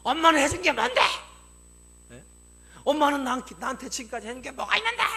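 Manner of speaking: high, loud adolescent yelling back; acted teenage tantrum, shouted high; rebellious teen outburst. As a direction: shout it at mom in full revolt. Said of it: "반항심이 팍 생겨가지고요".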